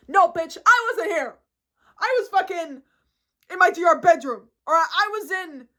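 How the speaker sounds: loud, high-pitched angry outburst; exasperated storytelling at full volume; angry ranting. Real, not acted. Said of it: "Me getting angry".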